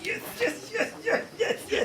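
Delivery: high squealed yeses, laughing; elated. Acted. Performer celebrating backstage as the crowd loves it, elated. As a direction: jubilant rapid-fire yes in a very high voice. Backstage elation at audience response.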